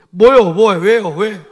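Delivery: loud, cheeky what-what-why; defiant talking back. Retold or imitated. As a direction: snap back at the pastor like a sassy kid. Defiant student mimicked.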